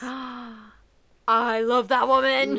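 loud, drawn-out declaration of love; gushing adoration. Real, not acted. Fan gushing about a favourite strategist, adoring. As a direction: gush it out, long and loud. Gushing over Bernie Collins.

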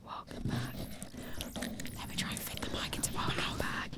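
soft whispered welcome; ASMR whisper. Pretend. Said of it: "let's do some A S M R".

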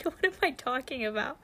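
ordinary talk

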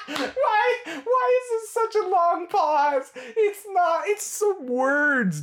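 very high, squeaky laughing talk; real helpless laughing. Real, not acted. Fan ridiculing the long pause, helpless laughter. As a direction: squeeze the words out through laughter.

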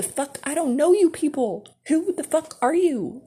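high, loud mock outrage; indignant outburst. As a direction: act out baffled outrage at staring strangers. Acting out indignation at strangers staring.

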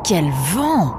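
mildly exclaimed, pitch swooping on the last word; understated cry of surprise; exclamation. A narrator's reading. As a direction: exclaim at the wind, drawing out the word. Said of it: "s'exclama Nemrod en plissant les yeux".

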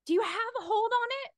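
high, quick questioning call; high-pitched, hurried question; girl calling up. Acted. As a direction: ask quickly in a high girl's voice. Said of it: "And she says".

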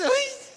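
very high "uy" squeal; hammed-up little yelp of fright; mock startled yelp. Imita susto del pasajero. (gritito agudo de susto fingido)

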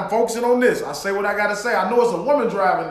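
usual speaking voice

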